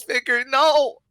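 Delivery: put-on high, loud kid's cry; child crying out. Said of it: "one child, who reaches out, says".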